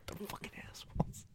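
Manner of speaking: whispered curse; muttered under the breath. Retold or imitated. Imitating how he says it at the hospital, whispered. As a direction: drop to a breathy aside, barely voiced. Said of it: "that's how he says it".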